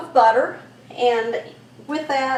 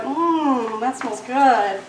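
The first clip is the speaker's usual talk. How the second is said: savoring a smell; much slower than usual. Real, not acted. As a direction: relish the smell, drawn out. Cinnamon aroma fills the pan.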